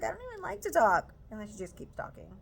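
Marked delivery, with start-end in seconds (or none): 0.0-1.1 higher, louder mock whine; mocking mom. Mocking the mother's complaint, higher mock whine. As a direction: imitate her complaining. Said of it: "She's like".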